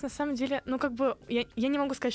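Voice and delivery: ordinary talk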